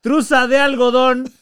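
sing-song street hawker call; underwear vendor call. Retold or imitated. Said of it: "así como lo estás diciendo".

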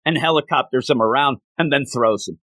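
normal talking voice

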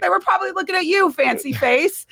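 fast, high, giggling through the words; laughing tease. Real, not acted. Wife teasing her husband about the women, laughing. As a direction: tease him while laughing.

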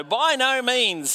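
high, slow and emphatic on NO; an emphatic, slow "By no means" with "no" lifted high. Retold or imitated. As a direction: land "no" hard, high and drawn out. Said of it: "Yes, it's an emphatic sort of a no".

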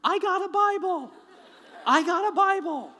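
high, very loud acted holler; yelling. Mugger runs to the girl in the car, excited yelling. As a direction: yell it back at full voice from a distance. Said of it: "he starts yelling at the girl".

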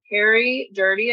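ordinary delivery, nothing marked

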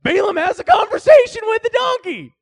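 high, loud incredulous exclamation; amazed outburst. Real, not acted. Preacher telling the congregation in disbelief, loud amazed shout. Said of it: "preacher marvels loudly".

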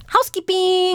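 high, sing-song housekeeping call; high chirpy maid announcing herself. Retold or imitated. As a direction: call out housekeeping like hotel staff.

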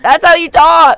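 high, loud protest; loud teasing retort. Real, not acted. Defending the impression to the co-host, loud high retort.

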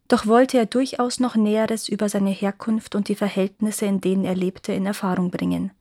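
usual speaking voice

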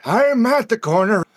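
put-on high, slow old man croak. Trucker answering dispatch in his old man voice. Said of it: "Talk like that to customers".

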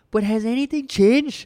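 high, slow, exasperated outburst; fed-up rhetorical shout; hotheaded shouting. Real, not acted. Heated rant about divided internet.

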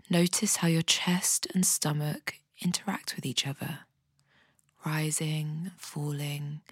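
a calm guide talking someone gently down into their body; guided meditation. Acted. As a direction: ease the listener into a body scan, softly. Leads a guided body scan.

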